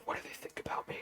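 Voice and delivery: breathy whispered panic to himself; anxious whisper. Retold or imitated. Guy whispering to himself after a joke flops, anxious. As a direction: whisper it like a spiral of social dread.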